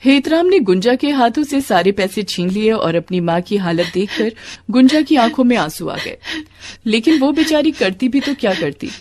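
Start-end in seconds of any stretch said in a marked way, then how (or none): none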